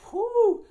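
loud, high-pitched "whew"; high whoosh noise. Retold or imitated. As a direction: imitate zooming off with a loud whoop. Imitated whoosh before walking away.

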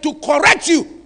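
loud, pounding delivery; preacher thundering about discipline; fiery preaching. Real, not acted. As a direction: hammer the words from the pulpit. Preacher at full force.

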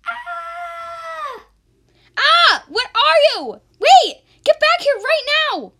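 high, loud shouts of alarm; startled yelling. Acted. Maya shouts after the stranger running away, high and loud. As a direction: yell after them in shock.

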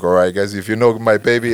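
usual speaking voice

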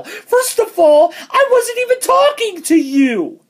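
high, exasperated comeback; indignant high retort. Retold or imitated. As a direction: squeak it out, annoyed. Snapping back at commenter.

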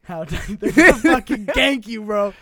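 loud, slow, laughing disbelief; loud laughing repeat of a friend's odd word; laughing outburst. Real, not acted.

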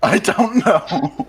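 high, drawn-out words through laughter; laughing, slow and high-pitched; laughing bewilderment. Real, not acted. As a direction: stretch it out, helplessly amused. Laughing at having lost the thread.

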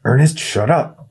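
put-on boy silencing his scared friend; snapped. A narrator's reading. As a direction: shut him down sharply. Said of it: "Ryan snapped".

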